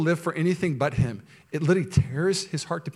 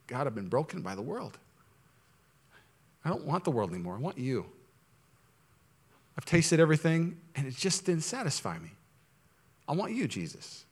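much softer, hushed prayer; soft surrender; 9 dB quieter than usual. Acted. A broken believer praying softly to Jesus. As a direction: melt into a broken, quiet plea. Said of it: "in a soft of saying".

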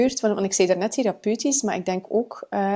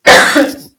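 loud cough; 14 dB louder than usual. Real, not acted. Guest coughing fit.

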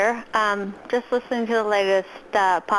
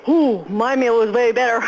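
mocking impression; 5 dB louder than usual. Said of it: "It's a hell of an impression".

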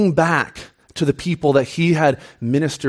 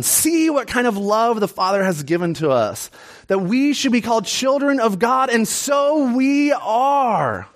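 excited reading; 7 semitones higher than usual. Acted. Pastor reading verse to congregation, building to exultant excitement. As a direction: read scripture with building fervour, stretching the final words. Reads verse with exclamation point.

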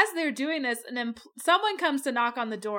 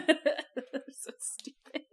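laughing whisper; 4 semitones higher, 5 dB quieter, much slower than usual. Real, not acted. Podcaster laughing at the dumb kids, whispered.

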